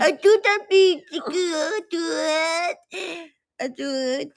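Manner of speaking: put-on high, loud baby voice; cutesy baby talk. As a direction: gush over him in baby talk. (malambing na baby talk)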